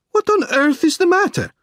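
high, alarmed demand to know; Pesca's startled cry. A narrator's reading. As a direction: cry out in high-pitched bewilderment. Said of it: "cried Pesca".

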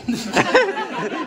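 high, loud laugh that falls; burst of laughter. Real, not acted. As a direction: break into real laughter. The arm has not budged.

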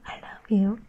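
normal talking voice

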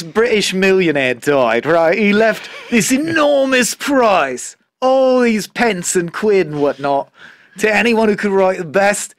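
lightly put-on Cockney storyteller, slow and drawn out; Cockney accent. Joking podcast host retells the limerick in a Cockney accent. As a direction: tell it like a Cockney bloke down the pub. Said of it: "he says Rita Rate does it in a Cockney accent".

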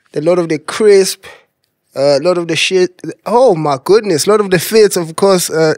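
usual speaking voice

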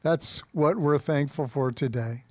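normal talking voice

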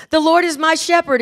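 loud, high recital, imitating a little girl; child reciting. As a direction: belt out the verse like a child onstage. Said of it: "And she goes".

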